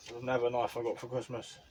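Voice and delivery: usual speaking voice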